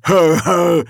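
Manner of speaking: loud, high-pitched troll guffaw; loud mock laugh in troll voice. A narrator's reading. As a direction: imitate a dim troll's menacing chuckle. Troll's threatening laugh, imitated.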